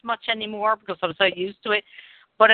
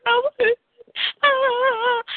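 mock crying. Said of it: "I'm always like".

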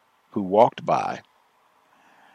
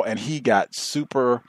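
ordinary delivery

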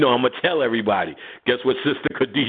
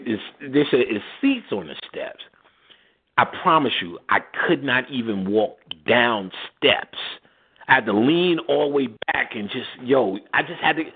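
ordinary delivery

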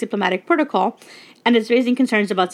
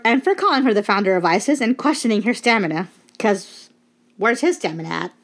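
ordinary delivery

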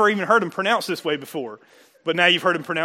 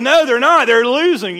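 higher, louder, half-playful outcry; mock dismay; 6 semitones higher, 8 dB louder than usual. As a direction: act out hearing the bad score. Mock sports news letdown.

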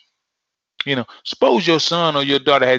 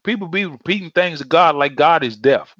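normal talking voice

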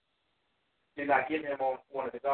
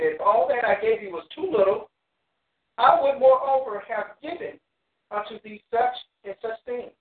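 loud, raised-pitch Bible reading; scripture proclamation; 8 semitones higher, 7 dB louder than usual. Acted. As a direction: declaim the verse high and forcefully. Reads scripture aloud to the class.

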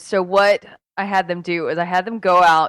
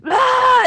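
short, high, louder imitation of a scream; mock scream; 14 semitones higher, 6 dB louder than usual. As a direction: re-enact your scream for the story. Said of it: "I'm screaming bloody murder".

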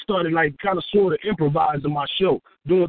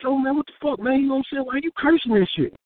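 annoyed partner; 7 semitones higher, faster than usual. Retold or imitated. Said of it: "He like".

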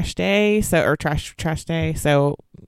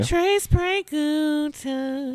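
much higher and slow, stretched out; high drawn-out echo; 12 semitones higher, 3 dB quieter, much slower than usual. Pretend. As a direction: sing out the phrase playfully. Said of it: "I have Trace Preguntas for you".

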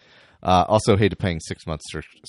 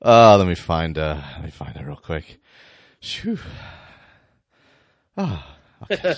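panting between words; breathless, catching air; out of breath; 4 dB louder than usual. Real, not acted. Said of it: "Out of breath email segment".

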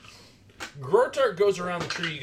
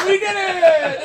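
much higher, louder, slow cheer; triumphant cheer; 12 dB louder, much slower than usual. Pretend. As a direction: celebrate loudly, laughing.

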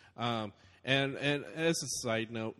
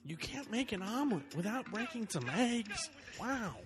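Owen Wilson voice; 10 semitones higher than usual. Pretend. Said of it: "That was a bad one".

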